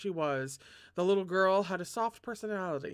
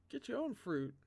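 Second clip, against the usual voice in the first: muttered; 6 semitones higher, 7 dB quieter than usual. Real, not acted. Muttered retort after shout.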